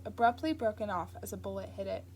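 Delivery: plain reading voice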